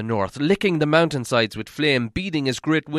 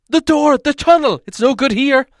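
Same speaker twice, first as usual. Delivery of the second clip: higher and louder, frantic; urgent warning; 12 semitones higher, 9 dB louder than usual. Acted. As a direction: hurry them back into the tunnel. Bilbo urging dwarves to flee.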